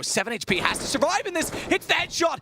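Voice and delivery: high, rising hype call; excited caster. Real, not acted. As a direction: build to a shouted call. Caster hypes surprise headshot.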